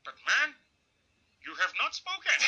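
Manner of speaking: high-pitched elder statesman impersonation; imitated old leader, each word set apart; Nelson Mandela. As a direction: chide him teasingly in the elder's measured voice. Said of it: "And he goes".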